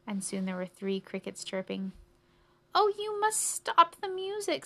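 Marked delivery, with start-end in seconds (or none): 2.7-4.7 high, strained plea; pleading mouse. Acted. Tired mouse begs three crickets to stop, pleading. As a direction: beg them from up high.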